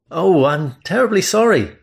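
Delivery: nervous, high apologetic blurt; flustered stammer. Acted. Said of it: "I stammered".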